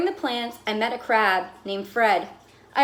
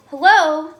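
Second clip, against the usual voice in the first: a bright, louder hello; called greeting; 4 semitones higher, 9 dB louder than usual. Acted. Fish calling hello to his silent bowlmate. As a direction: call out a cheery hello.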